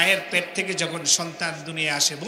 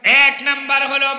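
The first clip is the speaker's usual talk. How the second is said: louder and higher, hammering the point home; emphatic repeat; 6 semitones higher, 7 dB louder than usual. Shown as a demonstration. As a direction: call it out so the crowd listens. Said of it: "খুব ভালো করে শোনেন". (জোর দিয়ে উঁচু গলায় ঘোষণা)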